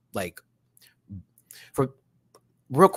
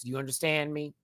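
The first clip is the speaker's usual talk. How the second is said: ordinary talk, nothing marked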